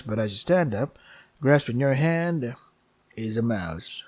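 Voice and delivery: steady storyteller reading of the game text; game story narration. Acted. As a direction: narrate it like a dark fable.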